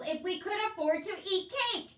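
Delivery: high, loud sarcastic protest; exasperated outburst. Acted. Poor Uncle told by soldier to eat cake, exasperated. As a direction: rise into indignant disbelief.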